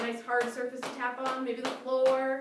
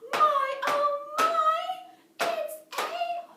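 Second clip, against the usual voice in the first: much higher gasping oh my; surprised; 8 dB louder, much slower than usual. Acted. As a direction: gasp in delighted surprise. Surprise at the door.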